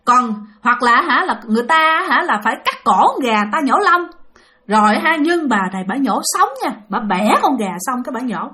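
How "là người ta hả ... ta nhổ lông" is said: plain reading voice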